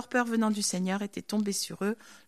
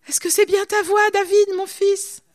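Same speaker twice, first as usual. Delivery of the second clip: appel de Saül; 9 semitones higher, 10 dB louder than usual. Acted. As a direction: call out across the distance, high and loud. Saul calls out to David. (appel lancé, fort, aigu et traînant)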